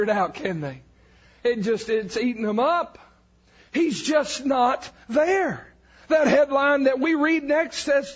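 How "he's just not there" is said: slow emphatic. Acted.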